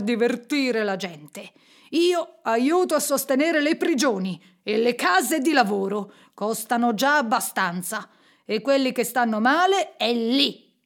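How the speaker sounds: put-on emphatic, irritated old miser's rebuff; curmudgeonly miser. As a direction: lecture them crossly, wanting to be left alone. Miser rebuffs charity collectors. (vecchio avaro scorbutico e seccato)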